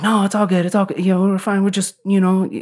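high-pitched, hasty-sounding reassurance; mock "nothing to see here" plea, pitched high; placating friend. Massimo placating the guy, acted out.

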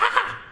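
sudden high yelp like a jump scare; startled yelp. Retold or imitated. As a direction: yelp as if jolted by a movie fright. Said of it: "everybody goes".

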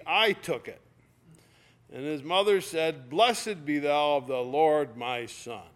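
loud, ringing Bible reading; scripture reading. Acted. Preacher reading Judges aloud to the congregation, loud and ringing. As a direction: proclaim the text to the congregation.

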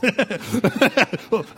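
uneasy chuckle from the crowd; a short awkward laugh; nervous laugh. Real, not acted. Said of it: "There's a nervous laugh".

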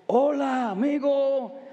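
unhurried Mexican Spanish hola amigo, each word given room; Mexican Spanish greeting. Retold or imitated. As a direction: greet like a relaxed Mexican brother. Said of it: "My brothers Mexicans, they say, example".

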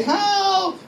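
high, drawn-out cry for help; acted high-pitched yell. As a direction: call out as if stranded on a cliff. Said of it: "so you cry out".

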